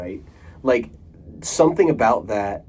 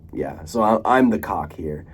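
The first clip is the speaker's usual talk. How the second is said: ordinary talk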